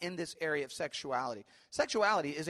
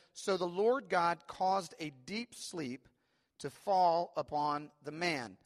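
slow, louder solemn Bible reading; scripture reading; 2 semitones higher, 4 dB louder, slower than usual. Acted. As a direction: lift the verse out like a proclamation. Reads scripture aloud.

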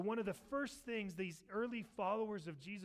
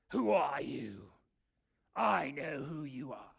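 loud, slow, drawn-out shout; crying out; 4 semitones lower, 7 dB louder, slower than usual. Acted. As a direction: cry out like a voice from the audience. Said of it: "a voice from the audience cries out".

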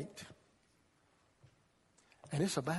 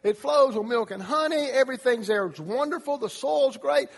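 higher and louder, raving about the land; excited report; 7 semitones higher, 6 dB louder than usual. Retold or imitated. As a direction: gush about everything you saw. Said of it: "they came back and said".

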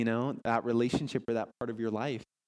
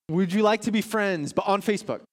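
cheery offer; 7 semitones higher, 4 dB louder than usual. Acted. Quoting a friendly offer.

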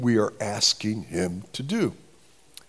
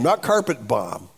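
usual speaking voice

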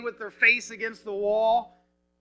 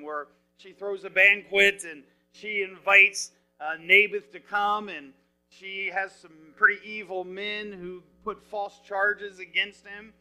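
ordinary talk, nothing marked

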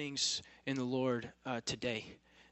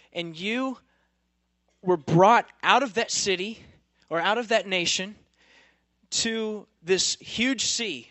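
rising, louder preaching of God's rebuke; 4 semitones higher, 8 dB louder than usual. Acted. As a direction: thunder it out like God scolding his people.

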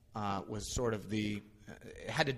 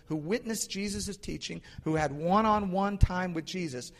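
raised-pitch, driving sermon build; emphatic preaching; 6 semitones higher, 5 dB louder than usual. Real, not acted. Preacher tells congregation how disciples kept missing it, emphatic. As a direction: pile up each clause with preacher's force.

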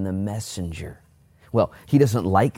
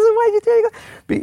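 very high, loud mock squeal; comic incredulous question; 7 dB louder than usual. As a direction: replay the question in an exaggerated falsetto. Same question replayed comically, laughing.

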